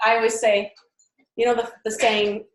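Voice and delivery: ordinary talk, nothing marked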